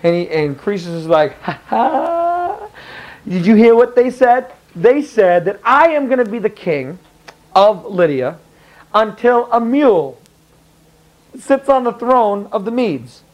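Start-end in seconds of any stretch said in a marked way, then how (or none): 1.4-2.7 high mocking ha ha; gloating laugh. The king gloats over the oracle with a high laugh. 3.3-13.2 high, triumphant king voice; gloating king. Retold or imitated. The king boasts to his court, high and triumphant.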